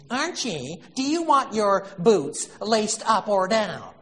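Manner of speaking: high, whining and drawn out; whiny wife voice. Retold or imitated. Edith whining to Archie about his boots. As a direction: whine it like the put-upon wife. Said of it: "in this whiny voice".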